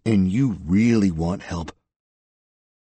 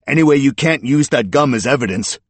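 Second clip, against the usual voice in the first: loud, high protest across the room; calling out; 7 semitones higher, 9 dB louder than usual. A narrator's reading. Suspect objecting to departing detectives, calling out. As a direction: call it after them, indignant. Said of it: "Eubanks called out".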